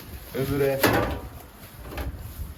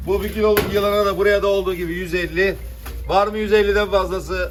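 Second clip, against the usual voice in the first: auctioneer; 6 semitones higher, 7 dB louder than usual. Acted. Fish auction calling prices.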